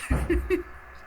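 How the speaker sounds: airy, high-pitched real laugh; a silent wheezing laugh; high breathless laugh. Real, not acted. Laughs at own story.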